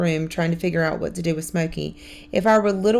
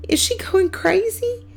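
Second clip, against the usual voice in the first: dismayed. A narrator's reading. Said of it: "Kermit says in dismay".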